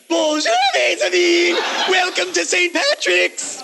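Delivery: high, loud mock priestly welcome; mock Archbishop O'Connor. Host in upside-down visor greets the cathedral as mock archbishop. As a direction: ham up a pompous archbishop greeting. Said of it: "Archbishop O'Connor!".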